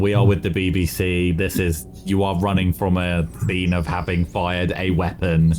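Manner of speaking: low, measured officer's warning; deadpan low-voiced authority; official warning. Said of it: "Probably like a".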